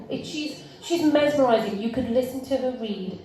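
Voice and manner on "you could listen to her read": normal talking voice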